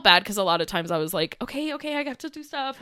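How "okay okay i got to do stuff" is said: higher, softer, placating; gentle brush-off. Retold or imitated. Busy bride to an attentive boy, gentle brush-off. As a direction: placate a child while hurrying off, softly.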